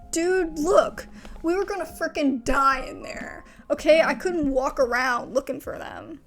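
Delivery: heated self-defence. Acted. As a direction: push back hard, justifying leaving them behind. Heated defence in game dialogue.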